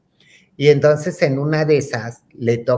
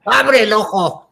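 high, slow, forceful order; drawn-out retold command; rough father; 6 semitones higher, 4 dB louder, slower than usual. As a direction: act out a dad ordering bluntly. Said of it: "agarra el bien tosco el señor".

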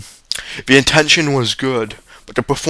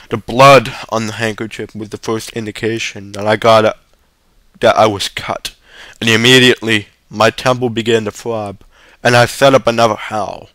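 usual speaking voice

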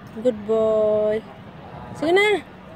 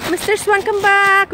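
coaxing call to swan; 6 semitones higher, 4 dB louder, slower than usual. Pretend. Calling the swan, slowed.

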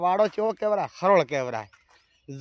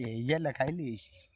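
soft echo; 4 semitones lower, 8 dB quieter than usual. Acted. Repeat falls to a hush.

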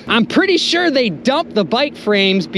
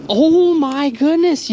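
normal talking voice

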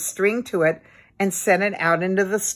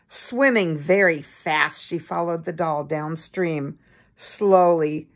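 ordinary delivery, nothing marked

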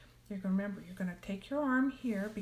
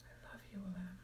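heartfelt whisper; 16 dB quieter, faster than usual. Shown as a demonstration. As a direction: whisper with all your feeling. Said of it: "Say it with all the feeling you can".

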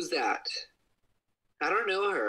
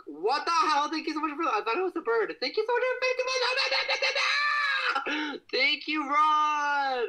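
very high chipmunk-pitched shout; squeaky filter voice; 13 semitones higher than usual. Pretend. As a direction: shriek it in a tiny high cartoon voice. Filter voice thanking followers.

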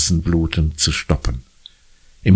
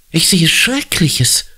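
hoarse; 13 semitones higher than usual. A narrator's reading. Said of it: "ruft sie heiser".

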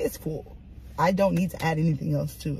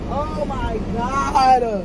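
loud, very drawn-out lament; exasperated groan; 5 semitones higher, 6 dB louder, much slower than usual. Real, not acted. Driver who cannot find a glove, long exasperated groan. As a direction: drag it out loudly, pitch raised. Upset over a lost glove.

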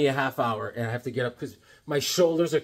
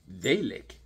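ordinary talk, nothing marked

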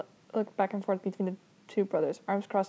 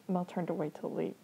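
ordinary delivery

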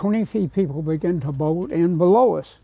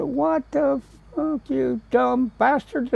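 indignant outburst; 7 semitones higher than usual. Retold or imitated. Artist shouting at the gunmen, high and outraged. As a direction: snap back at the gunmen in disbelief.

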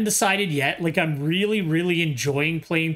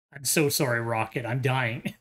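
slow, drained and worn-out voice; sleepy and exhausted, barely going; 4 semitones lower, 4 dB quieter than usual. Real, not acted. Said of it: "I'm dying on my feet".

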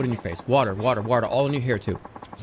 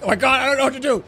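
fast, higher-pitched fluster; mock panic; 10 semitones higher, 5 dB louder, faster than usual. Imitating a viewer who is stuck for advice, in mock panic. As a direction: act out a hurried little panic. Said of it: "you're sitting there like".

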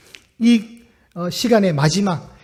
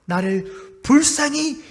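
slow, drawn-out plea, louder at first; pleading cry; 4 semitones higher, 4 dB louder, much slower than usual. Retold or imitated. Blind beggar crying out slowly to Jesus for mercy. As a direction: cry out for mercy, stretching each word. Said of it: "외칩니다".

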